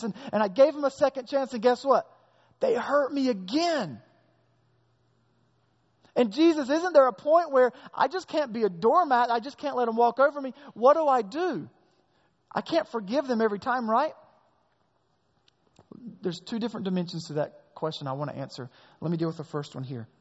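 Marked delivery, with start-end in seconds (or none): 6.2-14.1 raised, exasperated plea; pleading with Jesus. Pretend. Hurt churchgoer pleading with Jesus, raised and exasperated. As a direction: beg Jesus for a way out of forgiving.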